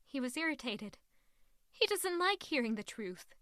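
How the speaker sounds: usual speaking voice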